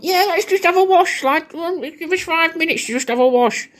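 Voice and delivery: high falsetto mock mum calling down; mum shouting down. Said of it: "And you're shouting down".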